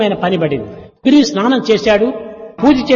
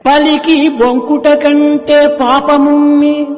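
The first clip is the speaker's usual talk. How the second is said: put-on slow, drawn-out recital of an old verse; 4 semitones higher, 3 dB louder, much slower than usual. Preacher quoting the king's verse, slow and recited. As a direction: intone the proverb slowly, weighing each word. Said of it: "బలిచక్రవర్తి చెప్పాడు".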